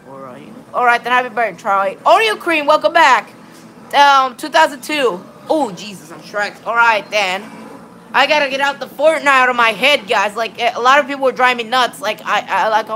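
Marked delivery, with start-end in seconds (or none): none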